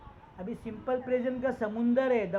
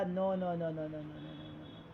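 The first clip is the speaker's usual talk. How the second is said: low string of noes fading away; repeated no, sinking and dying out; dismissive rejection; 7 semitones lower, 9 dB quieter, much slower than usual. Real, not acted. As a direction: reject each one, trailing down to almost nothing. Rejecting answers as they arrive.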